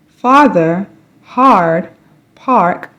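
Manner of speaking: normal talking voice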